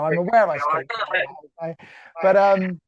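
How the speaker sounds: usual speaking voice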